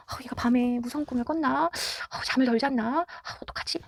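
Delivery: soft, worried thinking aloud; anxious murmur. Retold or imitated. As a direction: mutter your worries under your breath. Parent's anxious inner thoughts.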